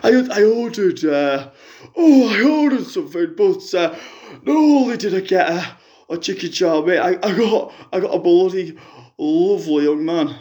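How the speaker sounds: a lightly put-on middle-aged fan on the phone; a fan phoning in with a sob story, lightly voiced; Jackie caller voice. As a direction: play the hard-up caller, chatty and woeful. Jackie tells her news.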